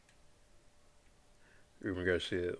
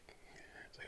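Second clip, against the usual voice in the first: whispering; 24 dB quieter than usual. Real, not acted. Whispered aside.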